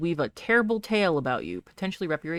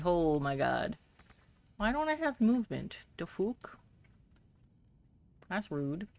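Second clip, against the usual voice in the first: normal talking voice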